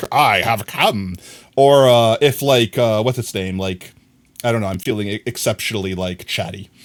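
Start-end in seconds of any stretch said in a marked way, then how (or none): 0.1-1.2 slow, mock-solemn announcement; grand entrance. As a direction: declare your arrival like a summoned being. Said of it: "I'm like".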